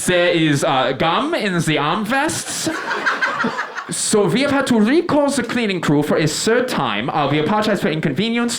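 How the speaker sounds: put-on high-pitched announcer with a foreign accent; airport loudspeaker announcer. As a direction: apologize over the PA in accented English. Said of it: "a voice comes over the loudspeaker".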